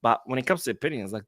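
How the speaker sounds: ordinary talk, nothing marked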